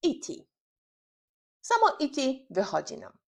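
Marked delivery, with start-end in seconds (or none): 0.1-0.3 "E T" as the isolated word ending ee-tee; "E T" set apart as a drill fragment; last syllables alone. Shown as a demonstration. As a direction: model the tail "E T" on its own, carefully. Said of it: "zaczynamy od ostatniej sylaby".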